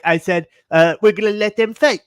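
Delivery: imitating a smug president; mock Trump voice. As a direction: ham up a smug leader. Mocking Trump quote.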